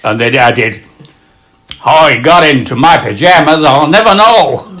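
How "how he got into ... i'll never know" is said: louder, higher comic delivery, building to the end; Groucho Marx punchline. Acted. Comedian adding to his elephant joke, mock-baffled punchline. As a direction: throw out the gag with mounting bafflement.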